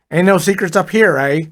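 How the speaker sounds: cocky Brooklyn guy with a Canadian eh; Brooklyn-Canadian accent. A narrator's reading. Fonzie brushes off the dead man's question in a Brooklyn-Canadian accent. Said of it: "his accent was somewhere south of Brooklyn with a touch of Canada".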